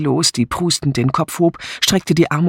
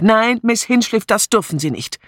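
urgent raised-pitch protest, falling off; called out; 8 semitones higher than usual. A narrator's reading. Sergeant holding Miss Hinchliff back, urgent call. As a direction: call out to restrain her. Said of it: "rief er".

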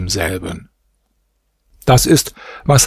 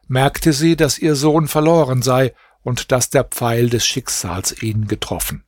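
ordinary delivery

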